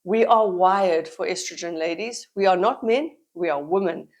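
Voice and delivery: ordinary talk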